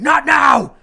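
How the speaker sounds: high, loud "not now!" bark; shrill furious brush-off; angry shout. Acted. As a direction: snap at the kids from the workbench. Dad snapping from the basement.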